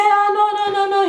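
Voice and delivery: high, sustained mock protest; string of high-pitched "no"s; imitating a French guy. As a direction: mimic a Frenchman waving it all away. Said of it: "you know, a French guy".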